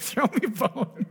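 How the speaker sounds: high, slow, laughing parody of a beggar's plea; a preacher laughing as he mocks a begging believer; mock whine through laughter. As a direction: chuckle while mimicking a whiny ask. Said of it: "I hear this a lot".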